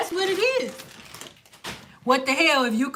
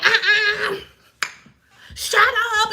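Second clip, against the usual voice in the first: laughing dismissal; 5 semitones higher, much slower than usual. Retold or imitated. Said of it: "Why in one breath it's like".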